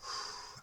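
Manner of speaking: slow audible exhale to settle; deep calming breath. Real, not acted. Reader calming herself before continuing, deep exhale. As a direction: breathe out to calm down. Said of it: "I'm gonna take a deep, deep breath".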